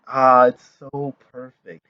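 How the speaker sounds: ordinary talk, nothing marked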